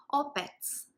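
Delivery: ordinary talk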